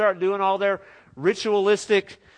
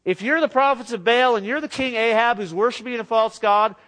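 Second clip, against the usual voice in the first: ordinary delivery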